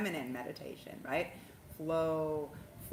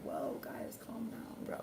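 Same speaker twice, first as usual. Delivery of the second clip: whispered calming; 4 semitones higher, 7 dB quieter, slower than usual. Retold or imitated. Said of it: "I'm like".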